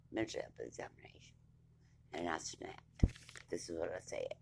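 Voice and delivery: breathy unvoiced whisper on another mental health examination; hushed and unvoiced, letting it sink in. Real, not acted. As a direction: drop to a bitter whisper. Bitter aside before quoting herself.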